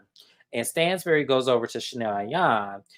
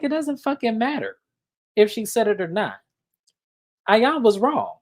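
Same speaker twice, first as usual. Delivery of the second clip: high, heated, louder outrage; indignant rant; 10 semitones higher, 3 dB louder than usual. Real, not acted. Podcast host fuming to listeners about Ayan, high and heated. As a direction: vent like you can't believe it. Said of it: "the amount of rage that I had".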